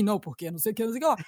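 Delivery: a hint of a put-on male voice; imitating a man. Shown as a demonstration. Showing how she imitates men, lightly mannish. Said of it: "homem eu sempre imitei falando assim".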